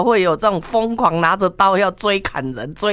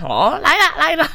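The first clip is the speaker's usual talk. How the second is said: high, drawn call to come over; beckoning call; 9 semitones higher than usual. Retold or imitated. Uncle with knife beckoning foreigners, high calling voice. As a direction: imitate a vendor waving people in, pitched up.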